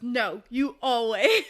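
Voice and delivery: drawn-out dry comeback; slow deadpan retort. Retold or imitated. Antony dryly retorting to Missus Featherington. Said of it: "Antony goes".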